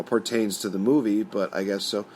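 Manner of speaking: normal talking voice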